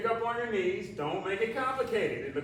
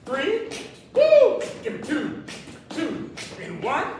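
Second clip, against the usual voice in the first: high, loud pumped-up rep count; excited countdown; 10 semitones higher, 7 dB louder than usual. Acted. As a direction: whoop between counts to fire them up. Counting down reps, whooping.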